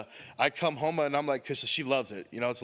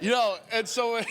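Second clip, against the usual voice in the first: laughing; 8 semitones higher than usual. Real, not acted. Laughing through his opening.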